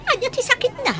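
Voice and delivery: mother startled by news of her sick son; alarmed question. Acted. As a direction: jolt upward in sudden fear for the child.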